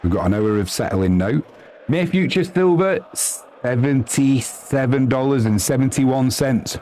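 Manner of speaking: ordinary delivery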